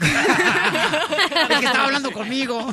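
laughing through the words. Real, not acted. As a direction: giggle it out. Guest laughing, touched.

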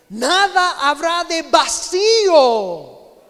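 rising sermon fervor; fervent preaching. Real, not acted. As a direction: drive each word home to the congregation. Preaching climax.

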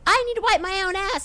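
high-pitched imitation of online griping; mock Twitter complainer. Podcaster mimicking people complaining on Twitter, high mock-indignant voice. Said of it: "everybody loves to go on Twitter and be like".

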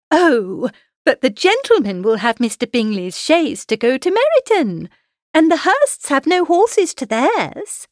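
high, insistent matchmaking mother; scheming mother, insistent. A narrator's reading. Mrs Bennet presses her scheme.

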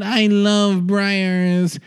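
high, very slow mock-orgasmic impression. Star swooning over yogurt in an ad, high mock-ecstatic drawl. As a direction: stretch each word out in hammed-up bliss. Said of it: "it's literally [name] having an orgasm".

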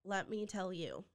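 ordinary talk

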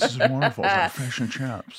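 lightly done actor impression with a laugh; light, amused take-off of the actor; Owen Wilson. Host likening guest's accent to an actor, light impression. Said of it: "like Owen Wilson was doing it".

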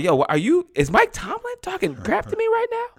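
high, incredulous squeak; stunned disbelief. Retold or imitated. Rookie realizing Mike Tomlin is trash-talking him, high incredulous disbelief. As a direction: sound floored by what you're hearing. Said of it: "and I'm like".

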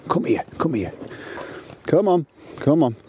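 high-pitched real dog call; lilting high call to a dog. Real, not acted. Calling the dog back.

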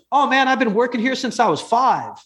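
a hint of Brooklyn street-kid accent. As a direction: imitate a young Brooklyn hustler. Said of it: "he sounds like a kid from Brooklyn".

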